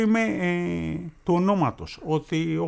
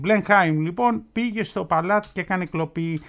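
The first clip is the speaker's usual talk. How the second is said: normal talking voice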